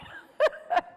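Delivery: high, airy burst of laughter; breathy giggle at the irony. Real, not acted. Laughing at her own story.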